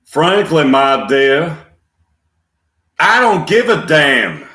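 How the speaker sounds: loud, high Southern kiss-off; deliberate Southern delivery, pausing before the punch; Clark Gable. Acted. Leaving, over his shoulder, emphatic Southern accent. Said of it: "Clark Gable in a Southern accent".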